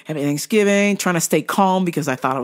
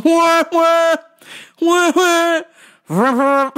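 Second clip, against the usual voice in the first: high, loud honking wah wah; mocking horn blasts from every car; 9 semitones higher, 4 dB louder, slower than usual. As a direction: imitate cars blaring at them. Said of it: "with everybody going".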